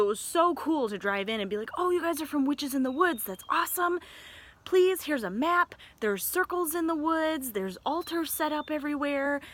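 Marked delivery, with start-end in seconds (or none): 1.8-9.4 high-pitched, gushing welcome in imitation; mock an overjoyed host showing guests round; enthusiastic greeter. Campground host greets the witches, enthusiastically.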